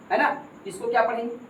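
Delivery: ordinary talk, nothing marked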